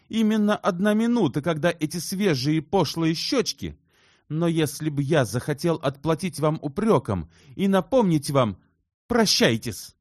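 high, loud agitated outburst; heated exclaiming. A narrator's reading. Shubin confesses to Elena then breaks off, heated. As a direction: blurt it out in rising agitation. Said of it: "воскликнул Шубин".

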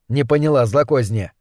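usual speaking voice

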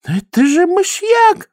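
very high, slow gasping cry; gasp of horror. A narrator's reading. Boggis gasping as Vimes eats the powder. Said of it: "ахнул Боггис".

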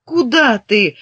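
calling out in surprise; surprised shout after her. Acted. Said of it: "удивлённо крикнула вслед ей Катька".

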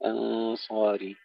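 أنصاري with thin hamza and thick nasal before S; weight the ghunna and sad of أنصاري; light hamza heavy sad. Shown as a demonstration. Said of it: "ترقيق الهمزة ثم تفخيم الغنة". (ترقيق الهمزة وتفخيم الغنة)

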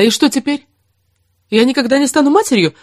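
pleading cry of despair; desperate cry. A narrator's reading. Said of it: "отчаянно воскликнула она".

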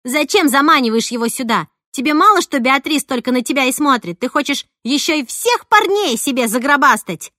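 loud, rising accusations; jealous tirade. A narrator's reading. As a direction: keep hammering the rival. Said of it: "напустилась на меня Снежана". (ревнивая ругань, громко)

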